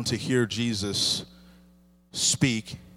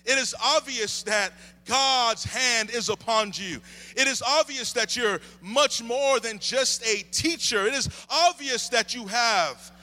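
higher, lifted preaching voice; 9 semitones higher than usual. Acted. Pastor preaching to the church, lifted and higher. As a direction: preach it out to the congregation.

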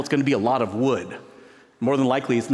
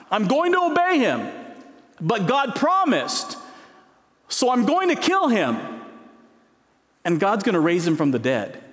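Abraham's resolve; 9 semitones higher than usual. Retold or imitated. Preacher voicing Abraham deciding to obey, high and resolute. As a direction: voice Abraham steeling himself.

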